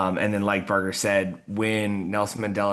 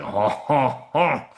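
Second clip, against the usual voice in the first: a teasing fake "ha, ha, ha", rising higher and louder; mock laugh; 5 semitones higher, 4 dB louder, much slower than usual. Teacher retelling how he laughed at students, mock laugh. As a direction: laugh at the class like it's a joke only you get.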